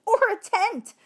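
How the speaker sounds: high, loud sudden blurt. A narrator's reading. Heidi blurting an answer out of turn, high and loud. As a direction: burst in before being called on. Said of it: "Heidi blurted out".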